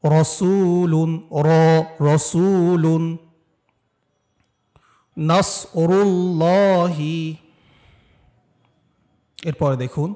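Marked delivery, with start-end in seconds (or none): 0.0-7.2 রসুলুন and নাসরুল্লাহি chanted slowly with a heavy র; slow recitation. Shown as a demonstration. Teacher models the words for students, slow and heavy. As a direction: draw each word out and keep the র thick. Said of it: "আমার মতো উচ্চারণ করার চেষ্টা করবেন".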